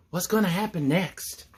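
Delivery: ordinary delivery, nothing marked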